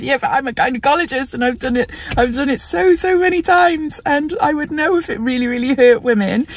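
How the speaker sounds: a light imitation of the reassuring gynecologist; doctor's reassurance. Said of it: "And he said".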